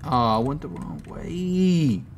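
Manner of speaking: ordinary delivery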